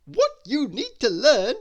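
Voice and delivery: Mister Tickle setting the dragon straight; firm character voice. Acted.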